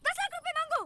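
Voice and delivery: high, loud correction; exasperated scolding. Acted. Hostage scolding her kidnapper over the ransom, exasperated. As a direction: berate a clueless accomplice.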